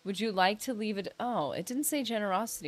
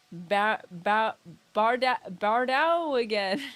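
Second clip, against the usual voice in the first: imitating her; 3 semitones higher, 6 dB louder, slower than usual. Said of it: "hear her say".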